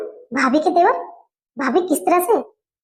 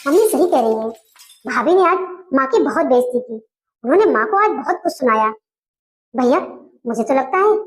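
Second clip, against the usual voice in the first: ordinary talk, nothing marked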